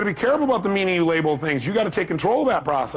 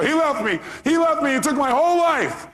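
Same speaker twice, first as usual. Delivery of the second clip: over-acted jilted woman, pitched way up; distraught caller; 10 semitones higher than usual. Jilted caller wailing to the radio host about her loss, distraught.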